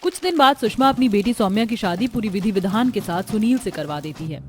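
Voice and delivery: plain reading voice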